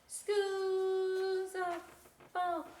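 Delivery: put-on little kid voice. Little Bat character voice.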